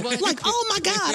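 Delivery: comic sees her idol, squealing; squealing excitement. Retold or imitated. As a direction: squeal with relief and joy. Excited greeting of George Wallace.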